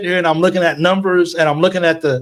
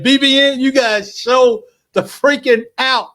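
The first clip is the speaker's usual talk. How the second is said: higher, overjoyed call-out to the fans; excited thanks; 8 semitones higher than usual. Real, not acted. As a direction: burst out in thrilled gratitude. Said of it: "didn't mean to be emotional".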